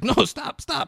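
high-pitched mock protest; mock pleading. Retelling a man begging her to stop, mock pleading. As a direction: plead to be left alone, jumping high.

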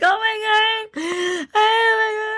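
very high mock wail; imitated hysterical crying. Teller crying as rescue arrives, hammed-up hysterical wail. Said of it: "I'm, like, crying".

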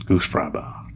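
breathy unvoiced whisper of Goose Fraba. Real, not acted. Podcaster signing off to listeners, whispering Goose Fraba.

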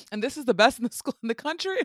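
high, loud, fast disbelief; incredulous. Real, not acted. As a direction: question it like you can't believe it. Incredulous about the rickety school.

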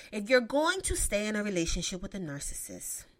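ordinary delivery

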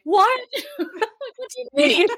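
high, laughing disbelief, mock outrage; shocked disbelief. Mother retelling the nurse's news, high laughing disbelief. Retold shock at being told no baby today.

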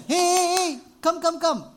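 high, loud call across a crowd. Retold or imitated. Jesus summoning the beggar, a high loud call. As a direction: wave him over with your voice.

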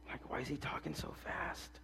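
voiceless whisper of a puzzled listener; mock whisper. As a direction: whisper it like an aside in the pews. Mimics listeners whispering about his pace.

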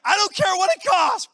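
hammed-up excited shout; giddy mock splurge. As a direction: throw caution to the wind. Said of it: "I'm like".

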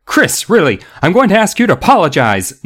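high-pitched, flustered scolding; indignant teacher. A narrator's reading. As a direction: demand it in outrage. Teacher scolds, face bright red.

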